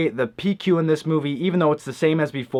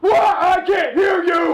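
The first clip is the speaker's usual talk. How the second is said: drill sergeant; 3 dB louder, slower than usual. Acted.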